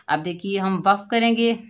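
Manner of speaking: normal talking voice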